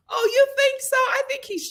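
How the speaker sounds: high-pitched surprised question. Real, not acted. Host reacting to a viewer's comment, high surprised question. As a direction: sound caught off guard.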